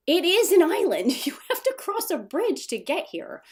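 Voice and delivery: loud, high-pitched, laughing protest; playful insistence. Real, not acted. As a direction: insist loudly and high, amused. Insisting playfully on disputed island.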